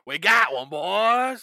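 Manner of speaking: loud triumphant hunter call; excited hunter shout. Pretend. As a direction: shout it like you bagged a Yeti. Yeti hunters calling out.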